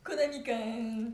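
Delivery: slow drawn-out name. Retold or imitated. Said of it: "って言われるんだ".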